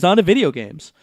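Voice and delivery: ordinary delivery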